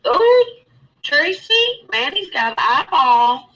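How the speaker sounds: real excited high-pitched reaction; excited squeal. Real, not acted. Excited reaction to caller's eyeball.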